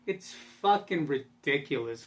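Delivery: usual speaking voice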